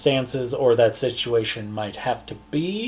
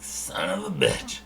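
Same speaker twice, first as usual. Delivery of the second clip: hushed, slower under-the-breath curse; a low curse, half to himself; muttered curse; 8 semitones lower, 5 dB quieter than usual. Acted. Dwarf recognizing the party mid-sentence, curses under his breath. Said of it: "and he's like".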